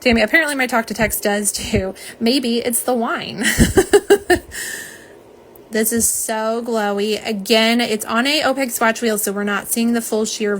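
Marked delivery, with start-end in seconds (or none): 3.5-4.9 breathless laugh. Real, not acted. Joking about the wine.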